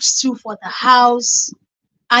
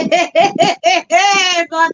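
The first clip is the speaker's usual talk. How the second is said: a loud, high laugh bursting out; loud burst of laughter; 6 semitones higher, 5 dB louder than usual. Real, not acted. Vlogger laughing at the market story, a loud laugh.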